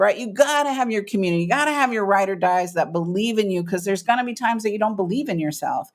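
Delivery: ordinary talk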